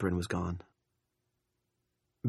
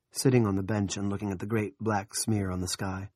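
usual speaking voice

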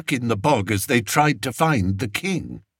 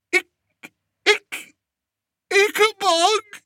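very high hiccuping moan. A narrator's reading. Shaken king moaning to his lords, hiccuping. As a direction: moan the name in fright. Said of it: "moaned Fred".

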